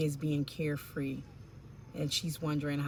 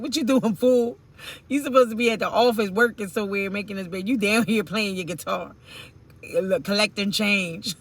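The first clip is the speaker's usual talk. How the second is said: put-on louder, scolding girlfriend calling him fool; mock scolding; 2 semitones higher, 8 dB louder than usual. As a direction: chew him out loudly as the annoyed girlfriend. Imagined girlfriend scolding the busker.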